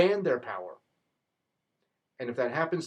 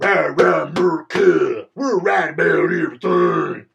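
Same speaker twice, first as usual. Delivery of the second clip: loud, slow, chest-thumping put-on voice; mock jingoist cheer; 10 dB louder, slower than usual. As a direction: bellow it like a brash flag-waving cheerleader. Mocking jingoist US leaders.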